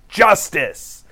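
imitating a defiant cry; defiant yell back. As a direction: throw it back as a retort. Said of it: "she yells back".

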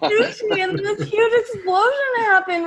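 high, loud panicked diner; panicked shout. Acted. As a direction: scream out in panic. Diner reacts to explosion.

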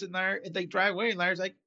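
ordinary talk

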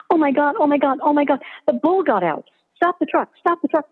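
high, frantic repeated pleading; panicked. Acted. Student begging her partner to stop, panicked. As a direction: babble it in panic at the driver. Panicking after bull falls out.

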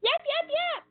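high, sharp fox yaps; yapping fox. Acted. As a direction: yap like a little fox. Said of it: "barked Reddy Fox as loud as he could".

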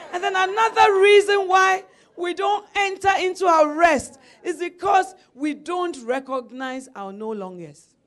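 loud, high pulpit shout; shouted sermon line; preaching at full force. Acted. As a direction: proclaim it to the whole church.

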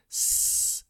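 the s sound alone, as a bare voiceless hiss; just the hiss of s, with no vowel. Shown as a demonstration. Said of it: "se hace el sonido de la S".

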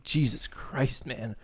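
slow and hushed, to himself; slow, stunned hush; muttered awe. Retold or imitated. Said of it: "and I'm like".

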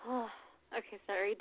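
ordinary talk

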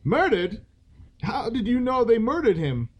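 high alarmed shrill question. Acted. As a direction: raise it, shrill and tense. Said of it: "His voice was shrill".

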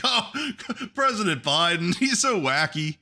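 high, giddy mock fan voice with a laugh; mocking Biden fans. Mocking a crowd that excuses Biden, high giddy gush. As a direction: play a fawning supporter gushing. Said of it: "everybody's just like".

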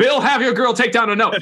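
a 1920s editor barking orders; mid-Atlantic accent. Retold or imitated. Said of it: "old-timey movie voice".

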